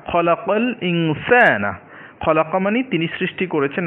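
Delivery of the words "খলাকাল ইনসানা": খলাকাল ইনসানা drawn out slowly, with a clear open আ at the end of ইনসানা; slow Quranic-style recitation of খলাকাল ইনসানা; careful slow recitation. Shown as a demonstration. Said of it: "এই শব্দের শেষের যে উচ্চারণটা".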